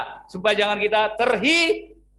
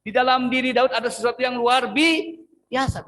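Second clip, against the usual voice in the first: higher and louder, stretched on biasa; emphatic repeat; 7 semitones higher, 5 dB louder than usual. Shown as a demonstration. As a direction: say it again with full force. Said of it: "Oke, saya ulang".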